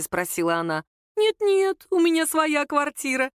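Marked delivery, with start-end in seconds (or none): 1.2-3.3 higher-pitched, louder flustered mother-in-law; hasty reassurance. A narrator's reading. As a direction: hurry to deny it in a high voice. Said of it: "заторопилась свекровь".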